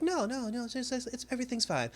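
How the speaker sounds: high, soft, stammered reassurance; imitated woman. Woman assuring him everything's fine, imitated high voice. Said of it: "But she was just like".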